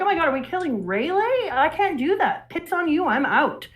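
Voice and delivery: high-pitched disbelief, replayed; mock outraged shock. Retelling own reaction to Rayleigh's possible death, shocked.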